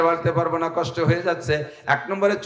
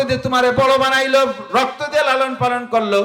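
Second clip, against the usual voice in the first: full-force preaching; 7 semitones higher than usual. Acted. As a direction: preach it out to the crowd, voice raised high. (উচ্চ স্বরে জোরালো ওয়াজ)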